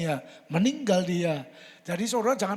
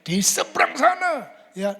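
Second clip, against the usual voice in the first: normal talking voice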